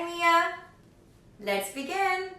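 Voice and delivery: ordinary delivery, nothing marked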